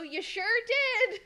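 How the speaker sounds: slow, high, fake-weepy sarcasm; mock crying. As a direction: whimper it out in mock despair, pitch way up. Sarcastic reply to broken hinge.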